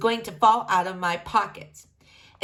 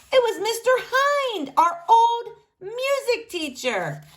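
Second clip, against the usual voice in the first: ordinary delivery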